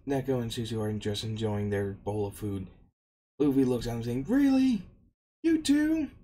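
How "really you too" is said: very high, loud disbelief; incredulous complaint. Retold or imitated. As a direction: squeal it as if betrayed. Luffy feels betrayed by crewmates.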